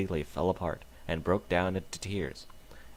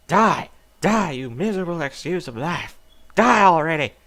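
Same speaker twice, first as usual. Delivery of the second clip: very loud, high screams of rage; possessed killer shrieking; murderous screaming; 7 semitones higher, 10 dB louder than usual. Acted. Stomping on victim's ribs.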